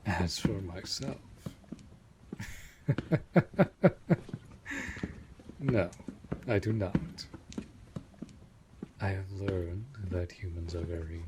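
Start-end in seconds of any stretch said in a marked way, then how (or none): 2.3-5.5 high, loud chuckle in a rapid run; amused laugh. Acted. Vampire amused by the human's question, laughing out loud. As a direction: laugh out in quick, rising bursts.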